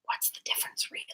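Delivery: whispered aside; murmured under the breath. Real, not acted. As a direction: drop to a breathy half-whisper musing to yourself. Musing aside in chat.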